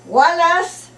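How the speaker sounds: acted yell. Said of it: "Yell at me".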